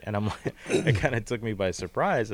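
ordinary delivery

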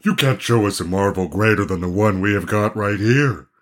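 scoffing gang-leader voice; scornful. Acted. Gang leader to the prince, scornful. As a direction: sneer it in a changed character voice. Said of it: "exclaimed the leader scornfully".

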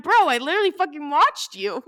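retold exasperation. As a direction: push it out loud, cracking into a laugh. Retold argument with denying guy.

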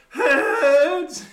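very high, loud strangled shout; panicked warning yell. Retold or imitated. Carter warning the man as his ball flies, yelling. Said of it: "instead of yelling "four," all he could get out was".

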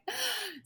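high sharp gasp of delight; a sudden thrilled intake of breath; excited gasp. Retold or imitated. Newly pregnant wife thinking whom to call, excited gasp. Five seconds pregnant, alone.

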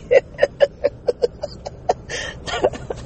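high breathy giggle. Real, not acted. Laughing at own joke.

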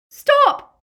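very high, very loud command; panicked piercing cry; Bellatrix's shriek. A narrator's reading. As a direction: shriek to halt him. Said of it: "shrieked Bellatrix".

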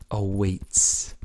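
ordinary delivery

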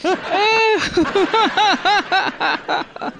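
long, high, helpless laugh; high laughter. Real, not acted. Host cracking up after his own joke, high laugh. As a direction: crack up at your own punchline.

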